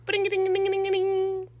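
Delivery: high sing-song ringtone imitation; phone ringtone. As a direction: chirp it out like a ringing phone. Imitating her phone ringing.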